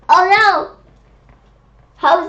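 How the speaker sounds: a high, loud "oh no!"; alarmed. Real, not acted. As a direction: cry out in alarm. Worried about Knox in box.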